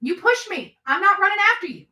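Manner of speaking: ordinary talk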